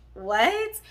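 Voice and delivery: loud, rising "what?"; incredulous. Retold or imitated. Storyteller reacting to girl's confession, incredulous. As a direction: blurt it with a sharp upward swing.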